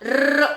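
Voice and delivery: र as a long rolled "rrr"; tongue-bouncing r. Shown as a demonstration. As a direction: let the tongue tip bounce up on र. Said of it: "उछल कूद होता है ऊपर".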